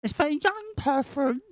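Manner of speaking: high lisping mimic of the young guest; mocking her voice. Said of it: "this is how she sounds in my head".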